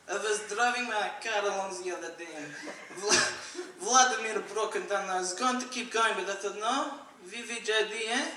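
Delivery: put-on comic Russian accent. Driver in Russia telling of breakdown, Russian accent. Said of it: "I wonder if in Russia".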